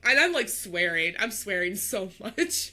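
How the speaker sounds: normal talking voice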